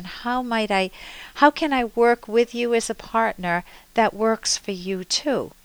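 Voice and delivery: ordinary delivery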